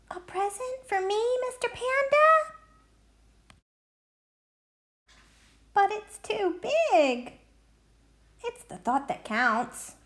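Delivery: put-on a child's picture-book critter, high and sweet; high sing-song creature voice; high animal voices.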